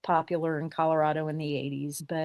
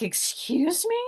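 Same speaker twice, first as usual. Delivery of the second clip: higher, affronted "excuse me"; a lady caught off guard and offended; offended surprise; 10 semitones higher, slower than usual. Retold or imitated. Woman taking offense.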